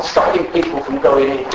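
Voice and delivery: ordinary talk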